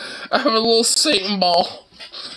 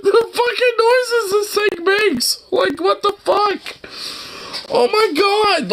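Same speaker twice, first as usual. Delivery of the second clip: high, strained words through real laughter and tears; laughing to tears; 13 semitones higher than usual. Real, not acted. Reacting to the toy's tortured demon noises, crying laughing.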